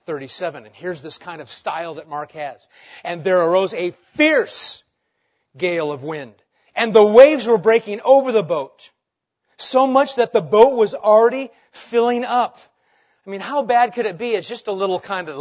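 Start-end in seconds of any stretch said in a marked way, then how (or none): 3.0-12.6 loud, rising storm reading; dramatic reading. Acted. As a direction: read it like the gale is hitting. Preacher reads storm verse dramatically.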